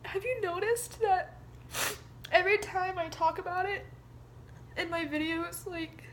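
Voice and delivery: high, tearful voice; halting words through held-back tears; trying not to cry. Real, not acted. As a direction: keep talking while close to sobbing. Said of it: "I'm trying not to cry".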